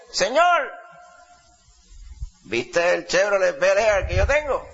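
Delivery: put-on high, slowed, proud old man boasting; old man's voice. Old man boasting to the Lord about his car, high slow old man's voice. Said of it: "hablaba con esa voz".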